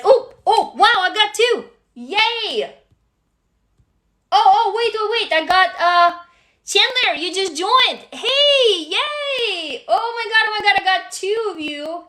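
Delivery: high, delighted squeals of welcome; excited. Real, not acted. Streamer thrilled that someone just joined, excited and high. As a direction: burst out with happy surprise. Surprised by stories and new member.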